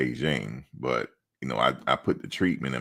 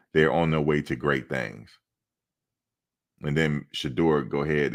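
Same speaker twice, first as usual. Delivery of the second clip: normal talking voice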